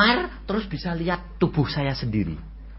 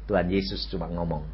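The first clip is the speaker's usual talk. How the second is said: ordinary delivery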